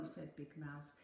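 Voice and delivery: ordinary delivery, nothing marked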